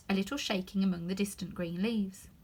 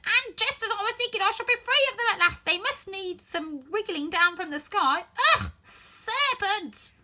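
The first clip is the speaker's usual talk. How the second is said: much higher, louder shriek; 12 semitones higher, 8 dB louder than usual. Acted. Pigeon shrieking about serpents from the sky, much louder. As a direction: work up to a shriek. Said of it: "raising its voice to shriek".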